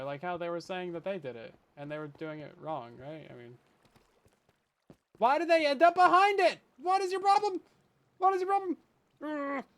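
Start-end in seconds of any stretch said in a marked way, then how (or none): 5.2-9.6 exasperated yelling. Real, not acted. Yelling at the game items.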